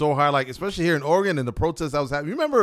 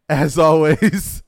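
outro; 3 semitones higher, 8 dB louder, much slower than usual. Acted. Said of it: "It's the Always Serious Podcast".

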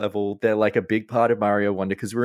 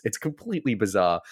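ordinary delivery

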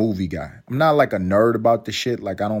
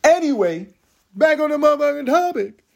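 comic high shout; 5 dB louder than usual. Pretend. Snapping back to topic.

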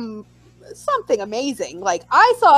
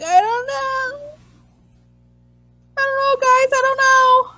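mock despair; 11 semitones higher than usual. Fan pleading ignorance to questioning friends, mock despair. Retold helpless reply to friends.

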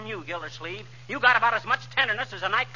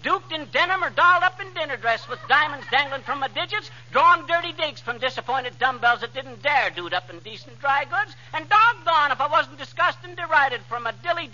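rapid patter; 3 semitones higher than usual. Acted. Breathless alliterative tongue-twister brag.

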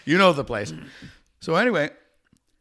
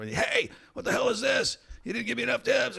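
high-pitched mock outraged waitress; indignant waitress; 13 semitones higher than usual. Waitress confronting customers who left a small tip, mock indignation. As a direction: call out a high, affronted complaint. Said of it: "stories like the waitress come".

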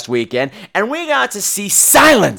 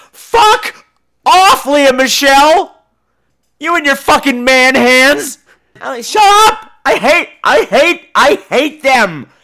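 high, loud comic tantrum; exasperated yelling; 7 semitones higher, 5 dB louder, slower than usual. Acted. Patient yelling back at the singing Glee cast, furious comic outburst. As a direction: blow up, dragging out the hate.

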